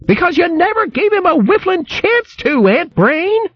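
high, scolding country woman voice; shrill hedgehog wife scolding. Acted. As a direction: berate him like a fierce old hog wife. Merklewort retorts to husband.

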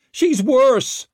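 high, loud accusing yell; furious shout at the healer; angry shout. A narrator's reading. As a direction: throw the blame at the witch. A man yells at the witch.

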